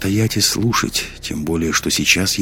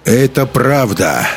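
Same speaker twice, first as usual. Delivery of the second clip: slow, higher, ringing declaration; raised, resonant interjection; loud, sonorous; 6 semitones higher, 3 dB louder, slower than usual. A narrator's reading. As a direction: ring out slowly over the hall. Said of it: "громкий и звучный голос".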